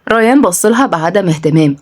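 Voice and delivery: ordinary delivery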